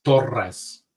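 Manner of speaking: TORRES with a trilled double R; Spanish tongue trill in the middle of TORRES; rolled R. Shown as a demonstration. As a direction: roll the R in TORRES. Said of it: "Yeah, there you go".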